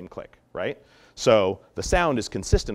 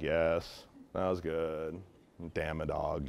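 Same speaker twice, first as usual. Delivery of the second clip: lower, softer mellow praise; a little mellow; 6 semitones lower, 8 dB quieter, slower than usual. Shown as a demonstration. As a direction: imitate a laid-back trainer, softly. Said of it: "If they're a little mellow".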